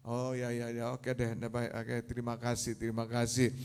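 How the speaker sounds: low, soft, mumbled acceptance; acquiescent. Acted. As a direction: give in quietly as the blind man. Blind man giving in to advice. (mengiyakan dengan suara rendah dan pelan)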